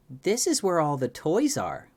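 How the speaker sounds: put-on high, loud child twin; Pip. A narrator's reading. Twin Pip lures child inside.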